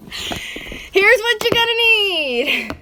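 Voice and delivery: drawn-out, high hollered intro; loud announcement. Pretend. Vlogger launching the supply list, slow high holler. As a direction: holler it long and high like a show opener. Mock-grand start of the tutorial.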